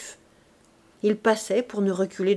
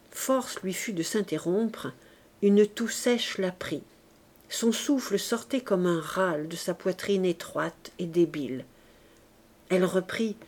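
usual speaking voice

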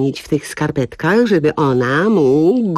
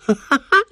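short bitter laugh; forced laugh; 10 semitones higher than usual. Acted. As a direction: laugh it off, high and hollow. Forced laugh after joke. (gorzki, wymuszony śmiech)

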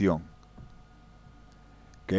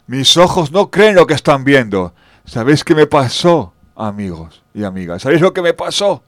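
louder, higher astonished telling; amazed; 4 semitones higher, 9 dB louder than usual. Acted. Storyteller hitting the audience with the big reveal, loud. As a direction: build the shock for the crowd. Shock at the grave, addressing audience.

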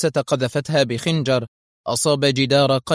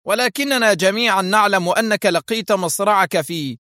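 raised, high-pitched insistent exclamation; protesting cry; 7 semitones higher, 3 dB louder than usual. A narrator's reading. Isaac protesting to the masked man, crying out. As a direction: blurt out the objection urgently. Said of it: "هاتفا".

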